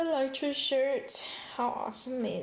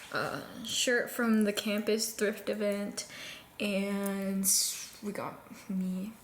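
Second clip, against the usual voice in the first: usual speaking voice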